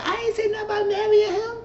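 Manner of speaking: very high, fast, whiny protest; indignant Lady Mae. Retold or imitated. Lady Mae denying talk of marriage, very high indignant squeal. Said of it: "She said".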